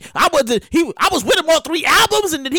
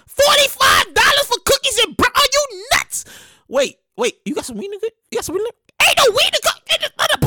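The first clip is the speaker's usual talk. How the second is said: price outrage; 9 semitones higher than usual. Pretend. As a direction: rant at the crazy price. Shocked by menu prices.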